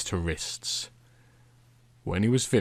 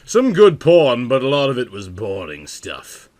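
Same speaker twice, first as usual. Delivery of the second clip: wry aside; 8 dB louder than usual. A narrator's reading. Narrator aside in louder voice.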